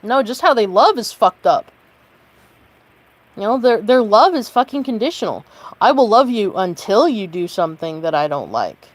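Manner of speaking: fuming, every line pushed hard; heated rant; sustained angry rant. Real, not acted. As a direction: keep venting the anger. Angry rant held loud.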